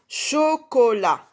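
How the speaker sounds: CHOCOLAT slow and loud, each syllable held. Shown as a demonstration. Teacher hunting the O with pupils, syllable by syllable. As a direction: stretch "cho-co-lat" into three high beats.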